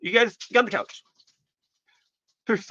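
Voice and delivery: talking through a mouthful; mouth full. Real, not acted. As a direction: speak around something in mouth. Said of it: "I'm gonna talk with my mouth full".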